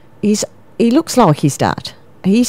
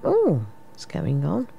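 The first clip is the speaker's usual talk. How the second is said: ordinary talk, nothing marked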